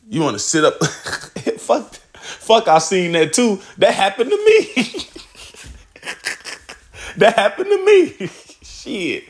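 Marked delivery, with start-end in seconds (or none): 1.6-4.8 laughing through the words; real laughter while admitting it. Real, not acted. Laughing at his own memory. 7.2-9.2 high, laughing repeat; much higher laughing take; laughing harder. Real, not acted. Laughing at his own memory.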